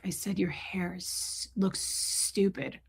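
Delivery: slow whispered insult; soft slow taunt. Retold or imitated. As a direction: hiss it slowly. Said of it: "she's like".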